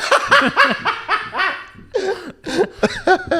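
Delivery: long, very high-pitched laughter; laughing. Real, not acted. Cracking up over a casting joke, high laugh. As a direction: burst into a loud, high laugh.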